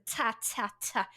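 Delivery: softly spoken "ta, ta, ta" with hissy T; T-like articulation. Shown as a demonstration. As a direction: separate each "ta" with a puff of air. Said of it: "it really sounds like a T".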